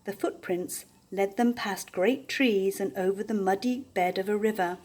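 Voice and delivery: ordinary delivery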